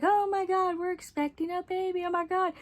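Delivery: high, squealing mock excitement from the happy parents; mock gushing parents. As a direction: gush like overjoyed parents, hammed-up. Parents gush about the pregnancy.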